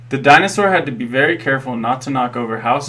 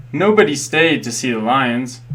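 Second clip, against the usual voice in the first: plain reading voice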